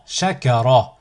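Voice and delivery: syllables joined as one word. Shown as a demonstration. Teacher models a word for learners to repeat, joined in one go. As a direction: run the syllables together without pauses. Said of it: "ensuite le mot".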